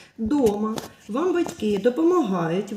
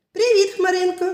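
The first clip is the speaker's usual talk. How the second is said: high, louder friendly greeting; cheerful puppy; 8 semitones higher, 5 dB louder than usual. Acted. Puppy greeting the cloud, cheerful. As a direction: greet the cloud like a happy pup.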